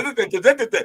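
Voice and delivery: laughing hammed-up Puerto Rican gibberish; mock Puerto Rican rant. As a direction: imitate her slipping into Puerto Rican talk. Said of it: "She, she started".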